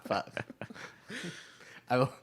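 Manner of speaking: ordinary talk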